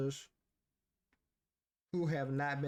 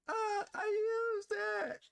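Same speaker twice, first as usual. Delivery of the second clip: mock sobbing. Mock crying over bad habits.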